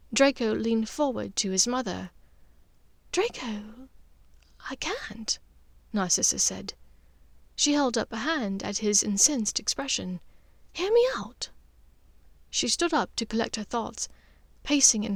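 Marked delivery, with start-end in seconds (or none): 3.1-5.4 gentle hushed no from a mother; Narcissa, soft refusal. Acted. Narcissa voiced by narrator. 10.7-11.5 a breathy, higher hear me out; mother calming her angry son; Narcissa, hushed plea. Acted. Narcissa voiced by narrator.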